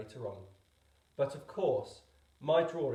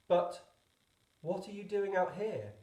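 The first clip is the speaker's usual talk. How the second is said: surprised question; 6 semitones higher than usual. A narrator's reading. Astonished pilot questions the stranger.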